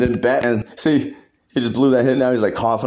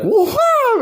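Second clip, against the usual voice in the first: very high laughing whoa; hammed-up high whoa; winded whoa; 4 dB louder than usual. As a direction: squeal from the gut punch. Hit in stomach.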